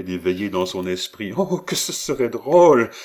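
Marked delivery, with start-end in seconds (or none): 1.4-2.9 high, loud exclamation of delight; wildly gleeful. A narrator's reading. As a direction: cry out, tickled by the idea. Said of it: "une gaieté folle et en riant aux éclats".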